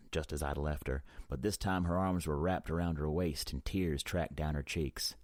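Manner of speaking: ordinary delivery, nothing marked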